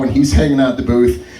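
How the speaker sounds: ordinary talk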